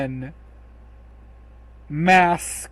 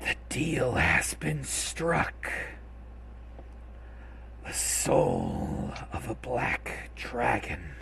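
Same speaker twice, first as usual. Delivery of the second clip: slow, hushed rasp of shadowy figures; eerie raspy whisper, drawn out; raspy voices; 5 semitones lower, 9 dB quieter than usual. Acted. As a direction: rasp it out slowly and low, like a dark chorus. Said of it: "with raspy voices say".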